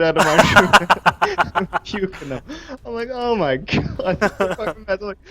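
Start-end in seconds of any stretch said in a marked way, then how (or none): 3.1-5.0 higher, drawn-out appalled groan; exasperated retelling. Retold or imitated. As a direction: replay the dismay, stretched out. Acts out finding vomit in his room.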